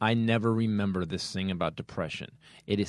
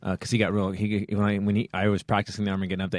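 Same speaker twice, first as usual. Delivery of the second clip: ordinary talk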